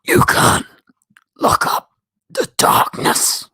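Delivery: hoarse, loud villain growl without pitch; Zoom voice. Shown as a demonstration. Streamer does Zoom for his live chat, gravelly villain impression. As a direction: rasp it out slowly and menacingly like a supervillain. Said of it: "Zoom voice, guys, Zoom voice".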